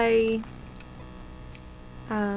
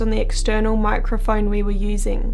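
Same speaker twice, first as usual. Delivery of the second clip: louder, even narrator read; documentary voiceover; 8 dB louder than usual. A narrator's reading.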